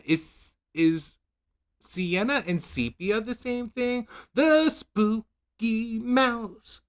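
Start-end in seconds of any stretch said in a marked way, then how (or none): 4.3-6.8 high, very slow spooky tone. Acted. Vlogger reading a product name in a hammed-up spooky voice. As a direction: drag it out like a ghost story.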